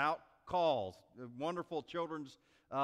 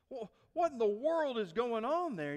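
high, agitated phone question; acted boss at his wits' end; exasperated boss; 8 semitones higher, 4 dB louder than usual.

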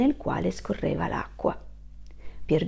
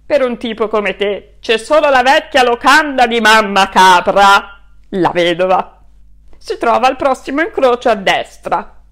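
loud, offhand stranger giving directions; rough local sizing up a shabby wolf; gruff passerby; 12 dB louder than usual. Acted. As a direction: brush him off with a blunt loud answer. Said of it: "Chiese a un passante".

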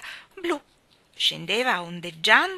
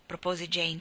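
plain reading voice

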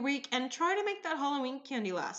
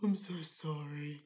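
slow, lower, gruff troll condolence; 7 semitones lower, 3 dB quieter, slower than usual. Retold or imitated. Sick customer consoling barista, slow gruff troll voice. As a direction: rumble it like a troll under a bridge. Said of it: "still sounding like the troll under the bridge".

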